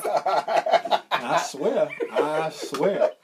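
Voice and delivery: loud, laughing "I swear"; laughing protest. Real, not acted. Protesting to his friend's song, laughing loudly. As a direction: swear it through real laughter.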